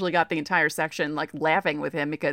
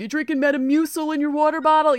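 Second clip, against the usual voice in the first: higher, louder mock ballpark heckle; imitated heckling; 10 semitones higher, 6 dB louder than usual. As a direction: call it out like a joking heckler. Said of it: "my brother was heckling the Diamondbacks bullpen".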